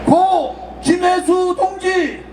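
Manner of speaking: slow, drawn-out call of names; solemn roll call. Real, not acted. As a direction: call each name slowly for the crowd to repeat. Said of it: "제가 선창할 테니까 따라해 주시기 바랍니다". (느리게 부르는 추모 호명)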